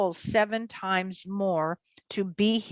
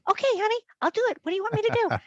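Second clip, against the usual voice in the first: much higher imitated voice; compliant friend; 13 semitones higher, much faster than usual. Best friend agreeing to the co-host's urging, eager. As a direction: put on a high, willing voice for him.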